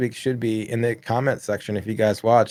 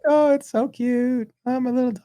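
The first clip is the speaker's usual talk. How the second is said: mocking neighbors; 13 semitones higher than usual. Mocking neighbors cooing over their little dog in a high voice. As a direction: coo like a doting dog owner. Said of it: "They're just like".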